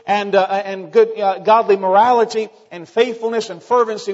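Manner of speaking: loud, high, fervent pulpit voice; full-force preaching. Acted. Pastor declaring his church's values to the congregation, full-force preaching. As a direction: drive every word home to the pews.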